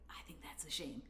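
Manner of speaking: softly whispered punchline; whispered pun. Shown as a demonstration. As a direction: breathe "I think that's a shame" as a whisper. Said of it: "I had to do it".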